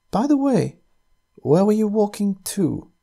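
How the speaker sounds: a casual, curious aside; woman's voice. A narrator's reading. Woman captor asks casually.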